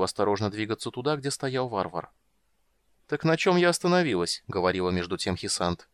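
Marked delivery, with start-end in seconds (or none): 3.1-4.4 mock casual. Dragon resumes mockingly.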